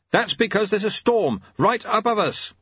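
high, strained-up cry; contained narrator's scream; mock scream. A narrator's reading. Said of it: "screamed Lobsang".

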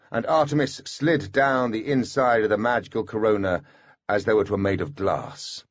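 plain reading voice

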